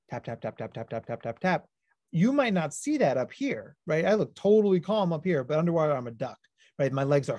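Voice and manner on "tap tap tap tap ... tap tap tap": low, soft mimicked foot taps; tapping sounds. Shown as a demonstration. A foot tapper showing the kids the tapping. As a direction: tap it out under your breath, steady. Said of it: "you're a foot tapper like me".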